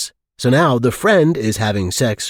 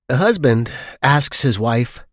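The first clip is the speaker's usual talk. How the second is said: ordinary delivery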